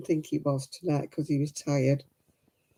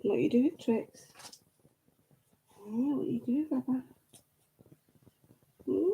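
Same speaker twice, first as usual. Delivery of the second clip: high, sing-song cooing at the dog; pet talk; 7 semitones higher, 7 dB quieter than usual. Acted. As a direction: coo sweetly to your pet like a baby.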